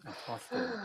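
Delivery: "Apostle" softly, letting the "all" ring out; drawn-out "all" sound. Shown as a demonstration. Host playing on the name, "Apostle" with an audible "all". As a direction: say "Apostle" so "all" is heard inside it. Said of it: "that all sound in it".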